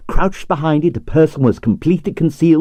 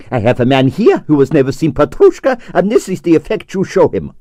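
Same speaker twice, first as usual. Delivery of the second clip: excited scolding; 7 semitones higher than usual. Acted. Stroganoff scolds the conductor.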